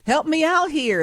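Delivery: high, loud cry for help from a cliff; desperate plea. Retold or imitated. Cliff hanger begging the mole for help, high loud plea. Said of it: "is what you're saying".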